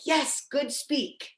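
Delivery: slow, high praise; "Yes, good speak." slow and high, dropping at the end; praising the dog. Shown as a demonstration. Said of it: "be like".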